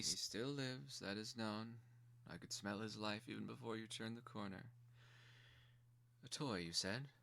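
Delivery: a ruling mother weighing her gift; stern quiet mother. Acted. Mother speaks from throne.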